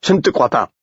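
high, appalled refusal; horrified, scornful disbelief; horror and contempt. A narrator's reading. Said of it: "L'horreur le disputait au mépris dans sa voix".

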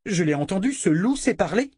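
high-pitched astonished exclamation; cri de stupeur. A narrator's reading. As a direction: insist in sheer disbelief. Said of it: "s'écria-t-il". (exclamation aiguë et stupéfaite)

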